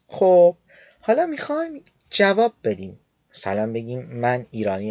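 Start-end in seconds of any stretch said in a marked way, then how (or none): none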